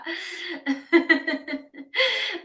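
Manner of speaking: a long laugh that nearly cries; long wheezing laugh. Real, not acted. Vlogger cracking up at a viewer's comment, long laugh. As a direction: laugh till it breaks.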